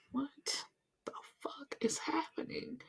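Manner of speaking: slow, hushed disbelief; drawn-out whisper of mock shock; stunned whisper. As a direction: whisper it slowly, utterly baffled. Acted stunned reaction to family chaos.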